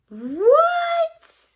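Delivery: very high, loud squeal of disbelief; can't believe her eyes; disbelieving what. Real, not acted. Gift opener squeals in loud disbelief.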